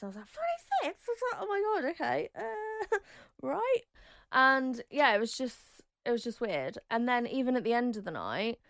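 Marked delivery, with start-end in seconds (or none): 0.3-3.8 high squeaky disbelieving gasp; stunned high-pitched laughing reply; shocked disbelief. Retold or imitated. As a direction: react like the number floored you. Shock at husband's age.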